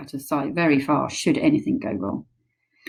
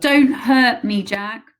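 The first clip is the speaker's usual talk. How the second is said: loud, higher, slow pleading yell; yelling plea; 6 semitones higher, 6 dB louder, slower than usual. Acted. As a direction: beg loudly not to be hurt. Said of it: "he yelled".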